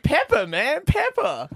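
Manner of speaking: high, insistent mock American "pepper"; American server. Servers telling an Australian the word, mock American accent. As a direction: correct the foreigner in a put-on American accent. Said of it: "little things we pronounce different".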